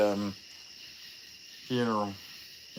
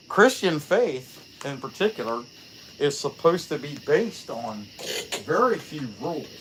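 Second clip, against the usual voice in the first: ordinary talk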